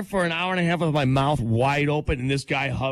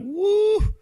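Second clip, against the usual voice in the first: high, loud woo at a bad mouth; mock disgusted woo; 3 dB louder than usual. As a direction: yelp at the smell. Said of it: "I'm like".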